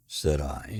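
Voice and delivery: usual speaking voice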